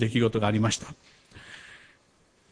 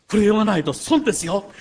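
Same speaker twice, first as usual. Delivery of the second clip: put-on insistent young woman; 6 semitones higher than usual. Young woman presses her book.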